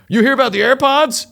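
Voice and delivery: high, loud call out; imitating a stranger calling from a window; cartoonish man at a window. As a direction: holler it like a cartoon neighbor popping up. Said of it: "like a cartoon and a man goes".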